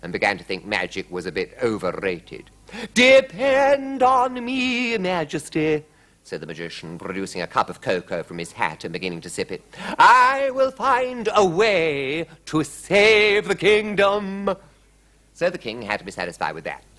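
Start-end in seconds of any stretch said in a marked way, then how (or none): 2.9-5.8 high, loud, drawn-out pledge; slow, lofty promise to a king; grand court magician. A narrator's reading. 10.0-14.6 high, loud, very slow vow; stretched-out heroic promise, pitched high; grand court magician. A narrator's reading. Magician grandly vows to help.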